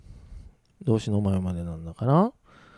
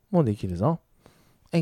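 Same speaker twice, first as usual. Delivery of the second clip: ordinary delivery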